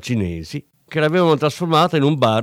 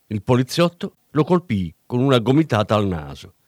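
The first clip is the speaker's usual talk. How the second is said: ordinary delivery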